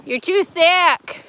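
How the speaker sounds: slow, loud, high-pitched teasing; loud, stretched mock complaint; mock exclamation. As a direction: call it out high and drawn-out. Teasing the stuck dog, acted.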